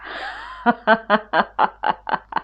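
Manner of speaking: big amused lion laugh. Acted. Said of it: "Lion laughed".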